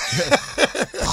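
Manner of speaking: high quick laugh. Real, not acted. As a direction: laugh at the crazy schedule. Guest laughs mid story.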